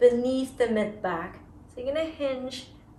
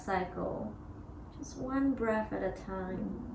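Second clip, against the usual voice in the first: guided meditation; 7 dB quieter than usual. Acted. Yoga teacher guiding students through breath, soft meditation voice. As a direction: ease them into each pause.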